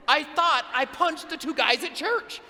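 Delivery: ordinary delivery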